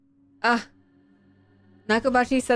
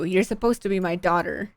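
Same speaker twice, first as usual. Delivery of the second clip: usual speaking voice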